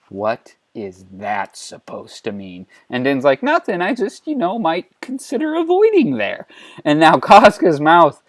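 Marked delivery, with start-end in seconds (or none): 0.1-2.7 low, slow, soft menace; quiet rage. A narrator's reading. 3.4-6.5 high-pitched sheepish evasion; nervous backpedalling. Acted. Nervous backing off after threat.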